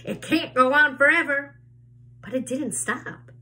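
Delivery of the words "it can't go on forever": louder, higher yell. A narrator's reading. Aunt Spiker yells at the peach to stop, louder and higher. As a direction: shout it up at the peach. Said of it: "Aunt Spiker yelled".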